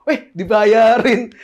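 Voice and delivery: very slow, stretched-out pleased cry; drawn-out delight. Pretend. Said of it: "kesannya kayak".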